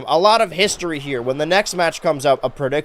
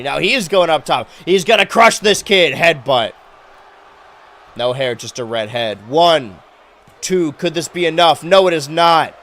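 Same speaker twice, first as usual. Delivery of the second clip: wrestling commentator; 5 dB louder than usual. Acted. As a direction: call the pin count like a ringside announcer. Live play-by-play of the match.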